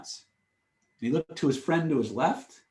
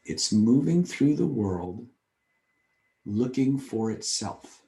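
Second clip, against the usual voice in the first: slow emphasis; 4 semitones lower, slower than usual. Real, not acted. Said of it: "slow closing point".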